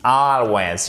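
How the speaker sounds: Always with a clearly marked stressed first syllable; clear word stress. Shown as a demonstration. Teacher demonstrating the tonic accent to learners, Always clearly stressed. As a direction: stress the start of Always, drawn out. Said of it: "on fait bien l'accent tonique aussi".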